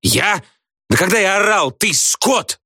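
very high-pitched indignant yell. A narrator's reading. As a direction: shriek it back in outraged denial. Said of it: "орёт Градусов". (возмущённый вопль высоким голосом)

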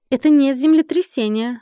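plain reading voice

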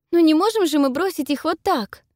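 high, loud desperate cry; desperate exclaim. A narrator's reading. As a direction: cry out in despair. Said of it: "в отчаянии восклицаю я".

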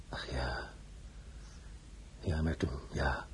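low, soft, halting recollection; subdued. Acted. Man recalling Marseille to an old acquaintance, subdued. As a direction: let it sink low and quiet, pausing. Recalls past, sighing resignation.

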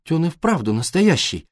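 high, wishful question; lifted, hopeful voice. A narrator's reading. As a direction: ask as if longing for a yes. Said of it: "с надеждой в голосе".

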